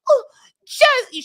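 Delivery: very high, loud hammed-up wail; mock hysterical shriek; Natalie impression. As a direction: squeal it in theatrical distress. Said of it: "let me do my Natalie".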